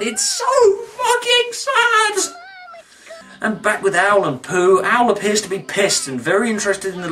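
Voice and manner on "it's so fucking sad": very high, slow tearful wail; mock crying. Reviewer weeping over Piglet's lost balloon, mock crying. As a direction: squeal it out as a hammed-up sob.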